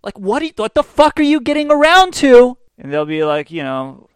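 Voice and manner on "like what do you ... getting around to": high, loud impatient demand; losing patience with a rambler; mock exasperated yell. Mocking listener's impatience.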